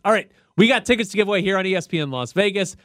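upbeat on-air giveaway read; radio contest promo. Real, not acted. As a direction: sell the ticket giveaway like a DJ. Giving away concert tickets on air.

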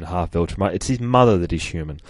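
normal talking voice

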